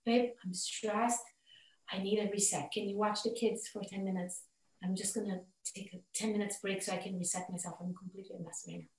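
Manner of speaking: soft, low, measured plea; calm request. Acted. Wife asking her partner for a ten-minute break, calm and soft.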